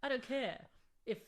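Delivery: ordinary talk, nothing marked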